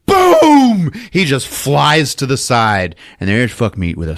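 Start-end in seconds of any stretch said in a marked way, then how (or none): 0.1-0.9 loud, drawn-out high boom; imitated gun blast. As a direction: boom it out like a shotgun going off.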